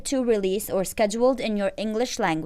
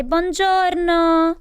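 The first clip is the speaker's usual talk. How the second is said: slow, drawn-out mock Italian greeting, higher and louder; Italian accent; 6 semitones higher, 4 dB louder, much slower than usual. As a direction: stretch it like a stage Italian. Greeting in Italian locale demo.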